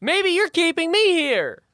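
high, loud flustered snake apologizing; high snake apology. Acted. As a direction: squeak out a nervous sorry.

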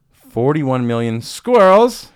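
announcing the top roadkill number; dramatic announcement. Acted. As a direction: boom it out like a big reveal. Reading out roadkill stat.

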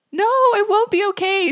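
put-on high, loud wail of a scared child; child crying. Mom voicing her tearful daughter, a high loud cry. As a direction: daughter protesting in tears on mom's lap, high crying wail. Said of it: "she cried".

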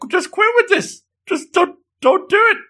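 very high-pitched mock exasperation; hammed-up girlfriend squealing for him to stop; exasperated protest. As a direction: shoot the pitch way up, building in force.

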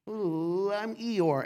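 lightly put-on mopey character voice; a hint of a woeful moan on "Ooh"; mock self-pitying whine; Eeyore. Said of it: "And I can say that".

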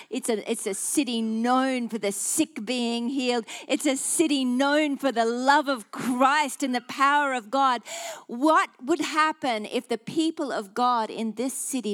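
loud, rousing declaration; building, fervent preacher's cry; impassioned preaching. Real, not acted. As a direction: proclaim it over the city at full force. Preaches at full force.

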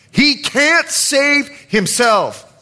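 high, slow jeer; mocking. Religious leaders deride Jesus amongst themselves, mocking. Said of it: "they mocked him amongst themselves".

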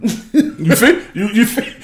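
laughing, high and gloating; laughing triumph. Real, not acted. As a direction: crow it through a laugh. Laughs, vindicated.

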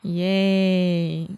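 long, level, unenthusiastic 'yay'; mock excitement. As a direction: feign excitement with one drawn-out cheer. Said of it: "you hear the excitement in her voice".

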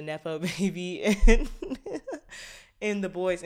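laughing through the words; giggling while naming the show. Real, not acted. Laughs mid-sentence about nepo baby.

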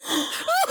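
sharp high gasp; shocked gasp. Real, not acted. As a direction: gasp in mock horror. Reacting to hornet news.

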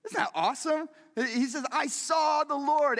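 high and bright, slowing on "I saw the Lord"; thrilled preacher exclaiming; excited awe. Real, not acted. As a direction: lift it up with joy. Excited preacher repeats the vision.

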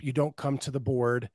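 usual speaking voice

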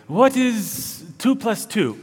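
high, slow mock teacher quizzing the class; Mister Jorgensen. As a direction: imitate a Danish teacher posing an easy question, drawn out. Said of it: "Mister Jorgensen would ask a really easy question".

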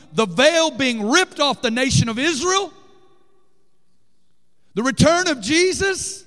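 preaching at full force. Acted. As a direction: climb into a roaring declaration.

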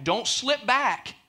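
"Don't slip back" high and slow; pleading slow caution; slow warning. Shown as a demonstration. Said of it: "the Bible gives us a warning".

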